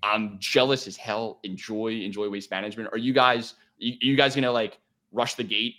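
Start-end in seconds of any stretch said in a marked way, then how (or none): none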